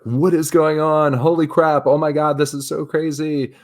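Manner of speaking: high, loud excited babble; freaking out. Retold or imitated. Retelling the call-up, high and loud excited panic. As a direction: panic with pure joy. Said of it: "I'm like".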